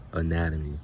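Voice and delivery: usual speaking voice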